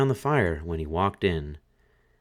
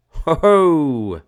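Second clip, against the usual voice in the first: higher, much louder gleeful skull; head bone crying; 8 semitones higher, 9 dB louder than usual. A narrator's reading. Dancing skull crowing at the fiddler, loud. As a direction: laugh it out like a grinning skull. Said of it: "cried the head bone".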